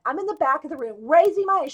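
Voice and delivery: ordinary delivery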